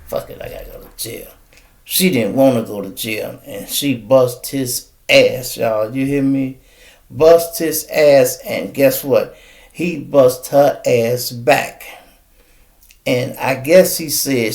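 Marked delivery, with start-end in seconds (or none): 0.1-1.3 mumbled aside. Retold or imitated. Imagined friend resigned. 7.1-11.8 slow emphasis. Real, not acted. Emphatic retelling.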